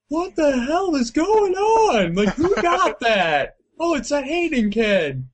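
high, slow bewildered players shouting; confused yelling. Retold or imitated. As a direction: act out baffled gamers asking what's happening. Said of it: "each team being like".

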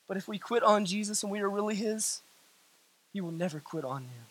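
very low, soft, near-murmured preaching; hushed preaching. Real, not acted. Preacher reassures those who quit on Jesus, hushed preaching. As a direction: let the voice fall right down for solemn assurance.

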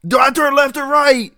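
high, loud frantic driver shouting; panicked driver. Pretend. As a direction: yell back in panic from the wheel.

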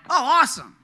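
high, loud burst of relief; retold happy exclamation; mock delight. Retold relief at waived fee.

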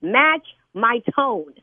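"Match my tone" stretched out at half speed; firm demand. Real, not acted. Woman repeating her order to a caller, slow firm "Match my tone". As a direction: lay down "Match my tone" slowly, each word weighted.

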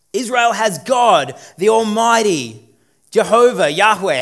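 loud, slow, ringing proclamation; preaching shout. Real, not acted. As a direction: proclaim it to the whole hall. Preacher proclaims God as king.

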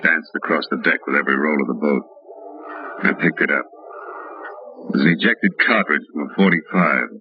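low, steady hardboiled storytelling; detective narration. A narrator's reading. Detective narrating his case to the listener, low and steady. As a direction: tell it like a tired private eye looking back.

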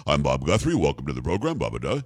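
low, booming radio announcer impression; imitating a subcontra bass radio voice; deep announcer voice. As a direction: drop deep like an old-school newsman. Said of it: "like a subcontra bass".

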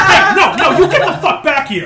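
yelling through laughter; angry laughing shout. Acted. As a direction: shout while cracking up. Shopkeeper yells, laughing.

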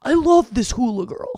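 put-on dad loudly defending his Hula Girl; hammed-up father refusing to let go; imitating dad. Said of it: "And he's like".

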